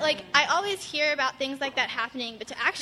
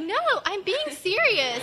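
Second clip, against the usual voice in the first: higher, slow insistence imitating the girl; mock earnest girl; 8 semitones higher, 7 dB louder, slower than usual. Imitating the girl who insists she's serious, mock earnest. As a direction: play her sincere protest lightly.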